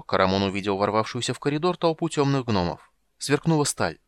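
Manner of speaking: plain reading voice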